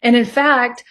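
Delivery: normal talking voice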